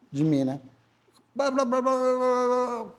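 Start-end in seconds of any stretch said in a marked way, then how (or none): 1.3-2.9 high-pitched imitated gibberish of a stranger talking; higher pitched mock babble of a foreigner talking at you; mock foreign gabble. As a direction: parrot a man rattling on in a language you don't get. Man speaks foreign language to him.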